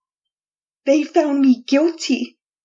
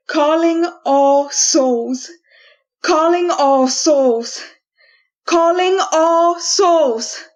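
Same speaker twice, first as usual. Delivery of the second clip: building incantation; 3 dB louder, slower than usual. Acted. Prisoner chanting to summon the souls, building chant. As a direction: summon the dead, slow and rising.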